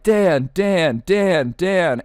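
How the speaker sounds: high rhythmic crowd chant; mock group cheer; imitated cheering. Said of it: "everybody starts cheering".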